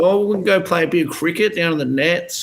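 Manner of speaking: high mock child voice, Australian; a high-pitched Aussie kid asking Dad; little bogan kid. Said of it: "a little bogan".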